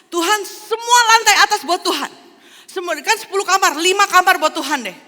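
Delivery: high, loud, hurried giving in; now offer everything upstairs, eager to please; John's eager concession. Acted. John hands more rooms to God.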